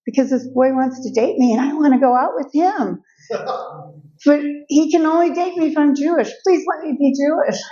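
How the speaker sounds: put-on high begging teenage girl, laughing mid-line; pleading teen. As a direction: beg your mom like a hopeful girl. Teen begging mother, voiced.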